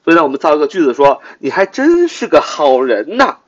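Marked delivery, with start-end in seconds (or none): 1.4-3.4 sarcastic. Shown as a demonstration. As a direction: praise them and mean the opposite. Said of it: "我讽刺地说". (讽刺地说反话)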